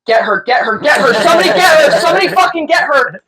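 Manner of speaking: high, rising panicky yell; urgent calling out. Retold or imitated. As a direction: imitate an alarmed coworker calling for help. Coworker urging others to stop her.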